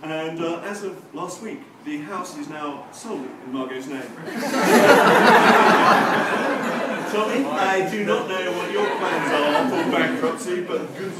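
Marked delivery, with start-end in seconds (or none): 4.1-7.1 big open burst of laughter; loud laugh. Real, not acted. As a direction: let out a hearty laugh. Audience joke lands.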